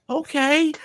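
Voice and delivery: a high yelp of an okay, caught off guard; surprised okay rising sharply. Retold or imitated. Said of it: "That's how I felt".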